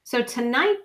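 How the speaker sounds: usual speaking voice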